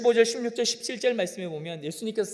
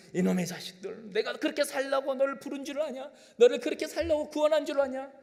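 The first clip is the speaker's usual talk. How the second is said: higher, mild hammed-up rebuke; mock scolding; 7 semitones higher, 3 dB louder than usual. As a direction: scold the children of God half-seriously. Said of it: "그렇게 말씀하시지 아니하시고". (가벼운 꾸짖음 흉내)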